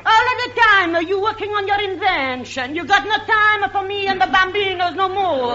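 hammed-up Italian mamma scolding her husband; Italian wife. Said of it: "I played Missus Guglielmo Marconi".

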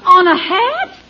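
baffled salesman checking; incredulous question. Acted. Salesman baffled by absurd request.

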